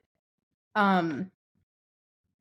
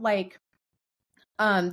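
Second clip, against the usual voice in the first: ordinary talk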